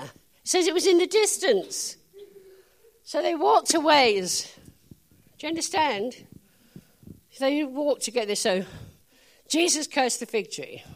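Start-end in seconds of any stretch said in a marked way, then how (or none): none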